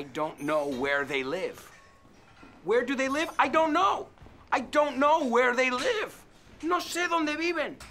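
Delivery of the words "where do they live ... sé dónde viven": high, loud exasperated cries; a high, loud, fed-up outburst; frustrated. Acted. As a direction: throw up your hands at not knowing. Acted frustrated character.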